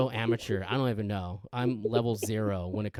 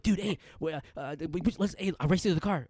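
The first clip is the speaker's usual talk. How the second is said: retold hyper excitement; 6 semitones higher than usual.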